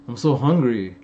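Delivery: normal talking voice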